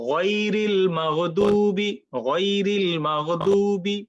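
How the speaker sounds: গয়রিল মাগদুবি drawn out, heavy ghain and dad; slow recitation example. Shown as a demonstration. Teacher reciting a verse example of heavy ghain. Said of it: "গ, গ".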